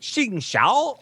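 high XING XIAO with swooping tones; exaggerated tonal XING XIAO; imitating the recorded woman. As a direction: copy her pitch on XING XIAO, rising at the end. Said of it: "this gal saying".

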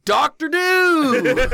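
high, slow, hammy mascot cry. Pretend. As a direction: announce it like a cartoon mascot. Names a soda mascot.

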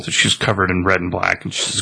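ordinary talk, nothing marked

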